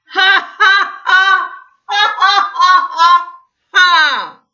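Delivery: high, loud booming laugh; God demonstrating a roaring belly laugh. Shown as a demonstration. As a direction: show him a big belly laugh. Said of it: "a good old belly laugh, like this".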